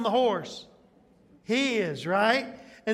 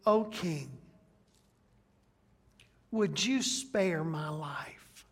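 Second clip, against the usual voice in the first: pleading; 3 semitones lower, slower than usual. Acted. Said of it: "And Esther says".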